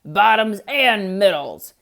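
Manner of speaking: loud furious holler; hollering bear. Acted. Bear hollering at Hare after being tricked, loud. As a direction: bellow his name in rage. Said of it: "he hollered".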